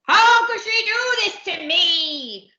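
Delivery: high, loud, drawn-out howl. Acted. Captain betrayed by his wife, slow outraged howl. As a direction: wail it out in outrage. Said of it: "howled Captain Abdul".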